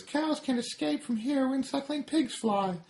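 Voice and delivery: very high tiny-cigarette voice; smug tiny cigarette taunting; high smug cartoon voice; Smoking Cigarette. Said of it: "Smoking Cigarette ... says".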